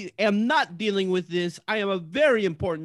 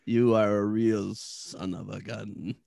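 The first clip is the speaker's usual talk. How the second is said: deep, slow menacing wizard; low and slow, barely holding his anger; angry wizard; 13 semitones lower, 4 dB quieter, slower than usual. Acted. Offended wizard about to cast.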